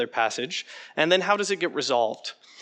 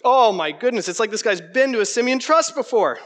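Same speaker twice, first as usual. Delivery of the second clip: delighted burst, higher and louder; excited; 7 semitones higher, 5 dB louder than usual. Real, not acted. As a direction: cheer the student's answer. Delighted at a student's answer.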